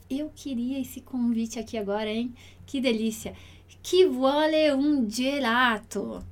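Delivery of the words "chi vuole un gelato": slow model reading. Shown as a demonstration. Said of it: "teacher models sentence slowly".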